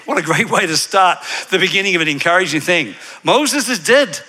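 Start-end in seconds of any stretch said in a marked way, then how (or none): 3.2-4.2 high, blunt announcement in slight Scottish; a touch of mock Scottish, pitched up; Scottish accent. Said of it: "Was that a Scottish accent?".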